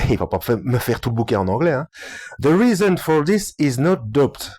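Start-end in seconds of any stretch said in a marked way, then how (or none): 2.4-4.5 halting French-accented English, a little higher. Real, not acted. Reading the English explanation to listeners, French accent. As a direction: read English carefully with a thick French accent. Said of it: "Mon accent anglais".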